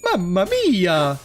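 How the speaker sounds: slow, drawn-out cartoon Italian accent. Playing the stereotypical Italian.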